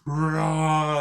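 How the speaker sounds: mock monster roar; a short hammed-up Nemesis roar. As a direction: growl it like a hulking monster. Retelling Nemesis roaring.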